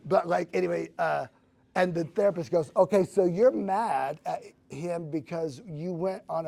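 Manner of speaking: loud laughing burst. Real, not acted. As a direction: still laughing while talking. Laughing, then quoting therapist.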